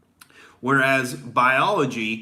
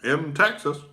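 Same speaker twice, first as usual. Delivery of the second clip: higher mock Obama voice; imitating Obama; 5 semitones higher than usual. Obama mocking the radio host, higher imitation. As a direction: do a light send-up of Obama's measured delivery. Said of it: "He's like".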